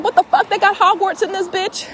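high, loud, disbelieving yell; a sudden high incredulous shout; stunned outburst. Real, not acted. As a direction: blurt it out in total disbelief. Shocked at psychic training camp.

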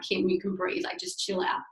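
hushed, breathy words to calm herself; self-soothing whisper. Retold or imitated. Talking herself down in a whisper during panic. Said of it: "I started to, like, hyperventilate".

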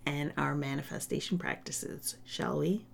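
playful whispered question. Real, not acted. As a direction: breathe it out, teasing. Playful aside ending podcast promo.

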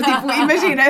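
giggly, high and loud; laughing. Real, not acted. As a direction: laugh it out brightly. Laughing excited aside.

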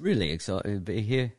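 playful British accent, a touch hammed-up. Guest greeting host in a joking British accent. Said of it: "Should we just do the whole podcast in an accent?".

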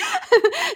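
loud burst of high laughter. Real, not acted. Laughing at her own joke, a loud high burst.

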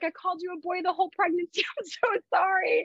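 put-on high, fast, teary sorry to the baby; retold tearful apology. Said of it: "I'm crying because I felt bad".

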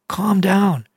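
normal talking voice

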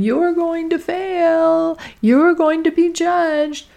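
high, slow, eerie taunting voice; high slow needling ego voice. Retold or imitated. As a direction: let the inner critic mock you, drawn out. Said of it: "our ego is out there saying".

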